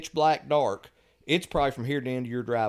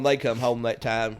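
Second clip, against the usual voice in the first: ordinary talk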